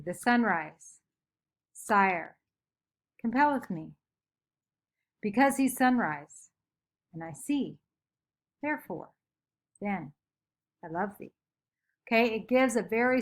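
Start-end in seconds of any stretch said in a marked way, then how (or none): 0.0-11.3 long deliberate pauses between each phrase; pauses within a line. Shown as a demonstration. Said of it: "it gives us a specific pause".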